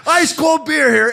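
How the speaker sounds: imitating the beer guy hawking beer in the stands; stadium beer vendor call. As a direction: belt it like an arena beer guy. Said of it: "he's up and down yelling beer".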